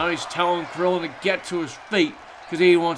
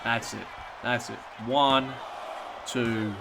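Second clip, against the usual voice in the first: low, soft somber commentary; wrestling commentator; 3 semitones lower, 5 dB quieter than usual. Acted. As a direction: mourn the fallen wrestler softly. Mourns Krillin's in-game death.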